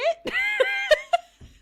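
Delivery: very high, loud squealing laugh; high happy shriek of laughter; delighted laugh. Real, not acted. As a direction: burst out in a shrieking giggle.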